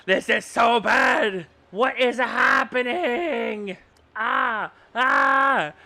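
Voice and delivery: slow, loud panicked cry; panicked yell. Real, not acted. Streamer panicking as avatar glitches, slow yell. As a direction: wail in alarm.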